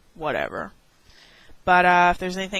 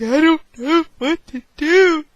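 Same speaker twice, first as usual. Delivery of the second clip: high, louder, panicky wail; panicked whine; 8 semitones higher, 6 dB louder than usual. Retold or imitated. Teen frozen in the library, whining high in mock panic. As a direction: whimper it like a lost child. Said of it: "and I'm just like".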